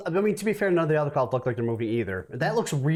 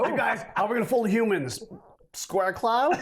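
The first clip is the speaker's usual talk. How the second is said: high, playful alien plotter; scheming alien; 8 semitones higher than usual. Acted. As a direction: pitch it up like a sneaky alien.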